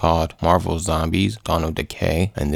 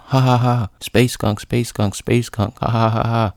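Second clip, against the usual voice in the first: higher-pitched mock laughing and taunting; Hulk laughing; 5 semitones higher than usual. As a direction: chant the taunt between pretend guffaws. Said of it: "He starts laughing".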